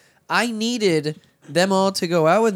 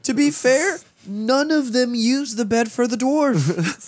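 defensive protest; 8 semitones higher, slower than usual. Real, not acted. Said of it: "defensive about the dwarf bed".

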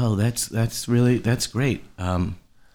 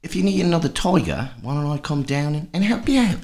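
put-on higher, half-hearted British accent; a touch of fake British, pitched up; British accent attempt; 6 semitones higher than usual. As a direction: try the British accent once more, higher. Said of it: "how about".

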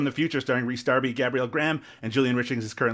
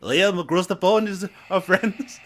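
higher, drawn-out mock English accent; put-on English accent; 5 semitones higher than usual. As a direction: tease your co-host in a hammed-up English accent.

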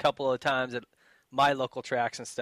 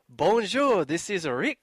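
mock French answering-machine greeting; hammed-up French accent on a recorded greeting; 4 semitones higher, much slower than usual. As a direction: put on a lilting French accent. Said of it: "talking in this French accent".

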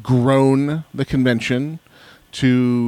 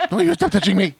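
high-pitched comic complaint; mock protest. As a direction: squeal it in a silly high voice. Mock protest as the touched knobs.

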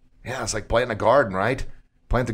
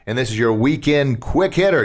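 ordinary talk, nothing marked

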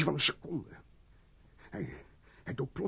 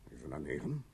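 usual speaking voice

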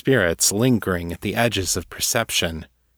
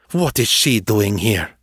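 a sharp, louder demand; 3 dB louder than usual. A narrator's reading. Ghost confronting Wells about the intruder, demanding. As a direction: push the question at her hard. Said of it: "she demanded".

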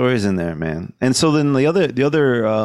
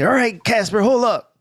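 lightly higher loony voice; talking to nobody; 10 semitones higher than usual. Retold or imitated. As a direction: chat to an invisible friend like a madman. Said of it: "talking to nobody".

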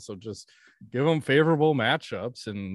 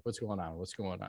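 low, much softer aside; muttered self-quote; 5 semitones lower, 13 dB quieter than usual. Retold or imitated. Viewer fast forwarding through a bad game, muttering. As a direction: murmur it to yourself, low.

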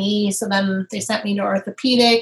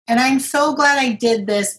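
ordinary talk

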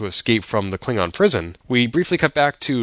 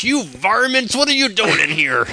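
high, twangy cartoon cowboy holler; Yosemite Sam voice; 10 semitones higher than usual. Co-host imitates Saru as a cartoon prospector, high and hammed-up. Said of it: "Yosemite Saru".

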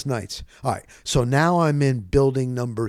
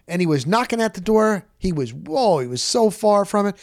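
ordinary talk

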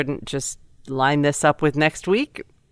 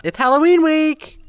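high ghostly wail; spooky voice; 14 semitones higher, 5 dB louder than usual. Acted. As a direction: sing it out like a ghost. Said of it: "spooky voice".

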